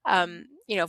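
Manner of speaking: normal talking voice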